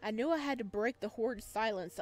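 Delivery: usual speaking voice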